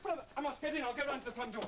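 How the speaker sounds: high, agitated rush of alarm; urgent, pitch well up; frantic urgency. Acted. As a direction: panic as you hurry to act. Frantic over the missing piano.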